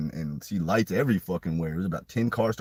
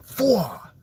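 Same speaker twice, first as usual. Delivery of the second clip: mock whoosh; 13 semitones higher, 5 dB louder than usual. Imitating the sound of jumping.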